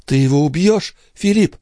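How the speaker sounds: high, urgent question; breaking voice. A narrator's reading. Sister asks brother to kill him, high breaking voice. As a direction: let the pitch climb as if about to break. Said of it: "спросила прерывающимся голосом".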